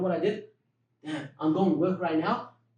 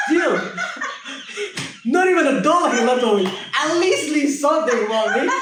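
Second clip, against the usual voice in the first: higher and louder, laughing through the complaint; laughing disbelief; 9 semitones higher, 6 dB louder than usual. Real, not acted. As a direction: laugh at your own bad luck, half pleading. Exasperated laughing retelling of being robbed.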